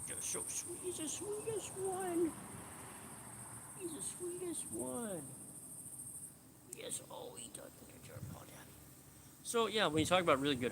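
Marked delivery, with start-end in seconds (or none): 0.1-5.2 cooing at the dog. Real, not acted. 6.8-8.7 soft unvoiced pet talk; fond whisper to the pet; whispering to the dog. Real, not acted.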